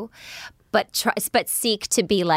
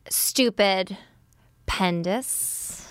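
"pendus" trails after a pause, softer; sneaky split word; 4 dB quieter, much slower than usual. Retold or imitated. Mother imitates three-year-old dodging a ban, "Stupid", pause, soft "pendus". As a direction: say "Stupid", wait, then slip in a quiet rising "pendus". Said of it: "he'll try to be creative".

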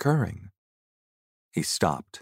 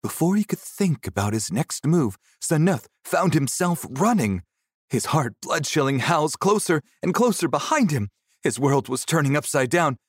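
higher, urgent storytelling; panicked chase narration; 8 semitones higher than usual. A narrator's reading. Narrator telling of Seneth fleeing the howls, urgent and panicked.

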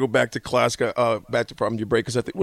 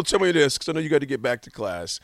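ordinary delivery

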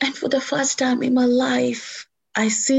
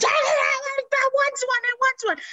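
put-on child's tantrum. Mimicking a child throwing a tantrum over a toy, whiny wail. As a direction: whine it like a kid on the shop floor. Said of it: "they will go down the floor and they'll start".

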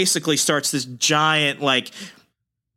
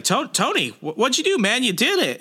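high, stammering imitation of panic; mock freaking out; 9 semitones higher than usual. Reed to Tony after dropping the mace, mock freak-out. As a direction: act out a flustered, much higher-pitched outburst. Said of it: "he's freaking out".

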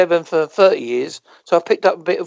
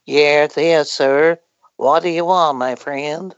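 put-on low, drawn-out American accent; imitated American, pitched lower; 4 semitones lower, slower than usual. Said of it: "talk to Americans, like, they go".

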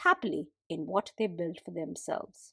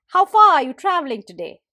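quick, loud, higher question; eager hare; 5 semitones higher, 10 dB louder, faster than usual. Acted. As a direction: rattle it off like a keen hare. Hare asks, fast loud.

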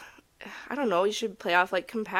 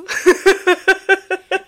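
high burst of loud laughter; 12 semitones higher, 12 dB louder than usual. Real, not acted. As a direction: crack up out loud. Big laugh at herself.

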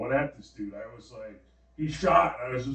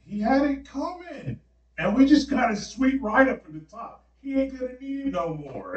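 mock justification; 11 semitones higher, 5 dB louder than usual. Dreamer justifying the killing to his friends, high mock-defiant voice. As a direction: defend yourself in a high, comic voice.

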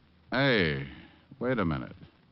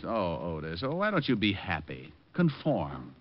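usual speaking voice

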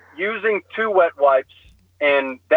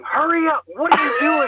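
retold scream; 12 semitones higher than usual. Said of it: "my wife is screaming".

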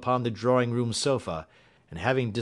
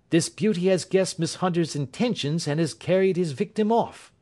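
higher-pitched detective voice; Holmes, indignant; 8 semitones higher than usual. Acted. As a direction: deduce the crime aloud, pitched up.

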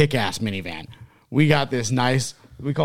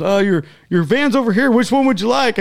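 eager clerk imitation; 10 semitones higher, 6 dB louder than usual. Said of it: "and he goes".

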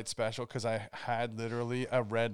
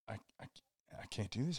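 hushed dread; 3 semitones lower, 11 dB quieter, much faster than usual. Retold or imitated. Acts waking at four, dreading.

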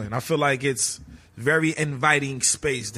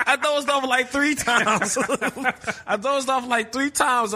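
laughing; 9 semitones higher than usual. Real, not acted. Laughing about dozing off.

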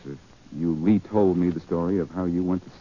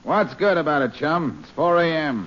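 higher, put-on gruff police voice on the phone; brusque impostor cop, pitched up; posing as Faraday; 6 semitones higher than usual. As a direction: pass yourself off as the inspector. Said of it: "This is Inspector Faraday of the police".

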